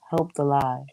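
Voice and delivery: ordinary talk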